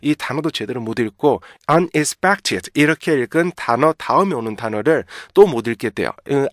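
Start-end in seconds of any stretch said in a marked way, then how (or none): 1.7-2.7 "unexpected" chunked as un, ex, pected; heavy, stiff misreading. Shown as a demonstration. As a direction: weigh down each piece of "unexpected". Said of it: "굉장히 무겁고 딱딱하고". ("unexpected"를 딱딱하게 나눠서)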